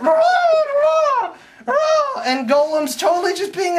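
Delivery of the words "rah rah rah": high, hammed-up battle yells; a silly falsetto war cry while fighting; mock battle cry. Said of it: "and it's like".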